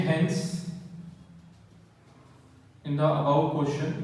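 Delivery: very slow, word-by-word dictation with long pauses. Acted. Teacher dictates the theory answer to students, very slow. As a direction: dictate each word so the class can write it down.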